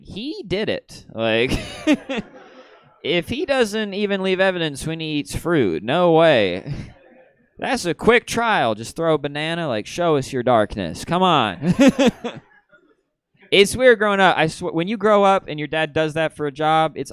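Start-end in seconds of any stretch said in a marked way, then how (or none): 0.0-1.1 knowing verdict. Retold or imitated. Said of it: "I was like". 1.6-2.4 high laugh. Real, not acted. Laughing at own joke. 11.8-12.4 loud high laugh. Real, not acted. Laughing at own joke.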